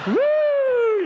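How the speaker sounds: high loud mock cheer; whoop. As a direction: whoop like a revival crowd. Celebratory whoop.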